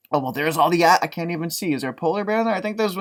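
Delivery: ordinary delivery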